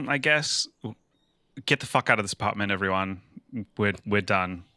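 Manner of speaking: ordinary talk, nothing marked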